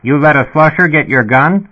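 a dispatcher crackling through the speaker; sputtering intercom voice. A narrator's reading. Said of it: "The intercom speaker sputtered".

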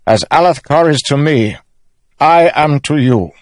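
high, ringing oath-swearing; loud, measured ceremonial declaration. A narrator's reading. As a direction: proclaim a solemn vow before witnesses. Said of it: "Elhokar said in a loud voice".

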